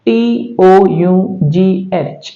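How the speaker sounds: slow letter-by-letter spelling; T O यू जी H said letter by letter, slowly; spelled out slowly. Shown as a demonstration. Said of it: "उसी तरह से".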